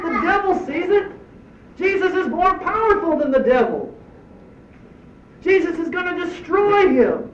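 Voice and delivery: high, rising frustrated appeal; exasperated plea. Pretend. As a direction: demand why they won't believe. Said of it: "we're sitting here saying".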